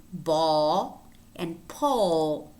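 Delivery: "ball" and "pole" slowly, with a dark final L. Shown as a demonstration. Teacher giving dark L examples, "ball" and "pole". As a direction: draw out the back-of-tongue L in "ball" and "pole". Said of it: "it might be dark".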